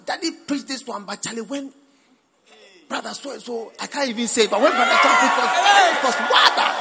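high, loud gushing student voice; imitating a raving student. Preacher voicing a student raving about Brother Tom. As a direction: gush like a fan of a preacher. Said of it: "You say".

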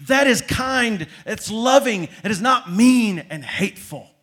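loud, high, driving sermon climax; booming pulpit delivery building up; full-force preaching. Real, not acted.

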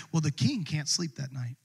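ordinary talk, nothing marked

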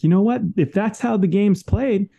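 a driven "just gotta be better" attitude; determined attitude. Retold or imitated. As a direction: refuse to lose, push yourself on. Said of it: "that attitude of like".

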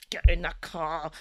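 stern command. Retold or imitated. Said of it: "giving her, like, this".

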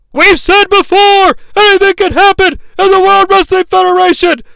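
high, loud, dramatic announcer proclamation; wrestling announcer. Retold or imitated. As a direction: imitate a ringside announcer at full pitch. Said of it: "He then corrects himself".

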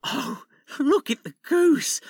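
delighted. Acted. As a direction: marvel at it. Father admires the goose.

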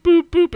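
high, loud boops; imitated scanner beeps. Someone scanning barcoded pigs, high mimicked beeps.

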